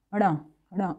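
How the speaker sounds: अड़ा as ण, tongue flipped back; retroflex nasal. Shown as a demonstration. Teacher demonstrates the letter ण, retroflex nasal. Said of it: "जीभ थोड़ा सा हमारी पलटती है".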